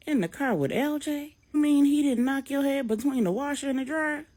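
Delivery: mother astonished her son rides with his attacker; incredulous Miss Brenda. A narrator's reading. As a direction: pitch it up in disbelief. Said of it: "Miss Brenda says".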